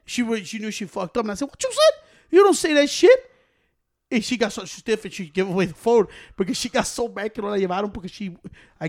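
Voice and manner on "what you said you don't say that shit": high, loud, indignant rebuke; acted outraged telling-off; retold scolding. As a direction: tell her off, voice jumping way up. Retells scolding the niece.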